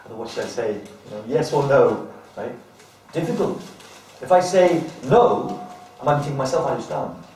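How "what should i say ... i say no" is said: ordinary talk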